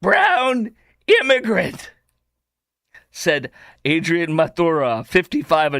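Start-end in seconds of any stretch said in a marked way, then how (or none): 0.0-1.9 high, slow mock proclamation; hammed-up declaration, word by word; mocking quote. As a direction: read it out with exaggerated grandeur. Repeats mocked quote after laughing.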